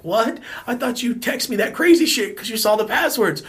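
high, loud mock disbelief, building to a shriek; incredulous outburst. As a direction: build from a high "what" to an amazed yell. Retelling incredulous reply to his text.

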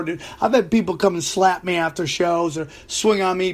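ordinary talk

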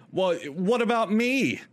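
high, drawn-out self-pitying protest; slow, high-pitched mock affront; mock indignant customer. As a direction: imitate an entitled customer pleading his case. Acted customer protesting the closure.

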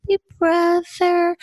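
put-on big sister gently cooing at her baby brother; gentle little girl. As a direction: greet the baby brother tenderly in a child's voice. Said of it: "real gentle".

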